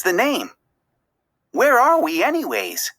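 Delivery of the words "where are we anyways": put-on higher young voice asking; a lightly voiced boy, puzzled; curious kid. A narrator's reading. Nemo asking Dory where they are, curious. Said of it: "asked Nemo".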